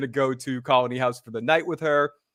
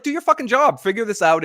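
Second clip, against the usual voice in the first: angry order; 8 semitones higher, 5 dB louder than usual. Retold or imitated. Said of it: "Boyd's like".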